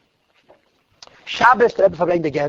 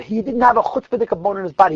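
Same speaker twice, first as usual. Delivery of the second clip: normal talking voice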